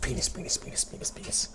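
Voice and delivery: soft breathy whispered chant; hushed mischievous whisper. Pretend. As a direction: whisper it over and over like a secret. Whispering the word repeatedly.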